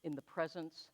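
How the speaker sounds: gentle prayer leader guiding the congregation to breathe; guided meditation. Acted. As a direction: ease them into calm breathing.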